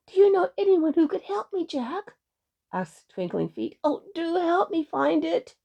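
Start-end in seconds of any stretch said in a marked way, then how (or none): 0.1-2.2 high, eager little voice; pleading pixie. A narrator's reading. Pixie asks Jack for help. 3.8-5.5 high, imploring little voice; pleading pixie. A narrator's reading. Pixie begs Jack for help.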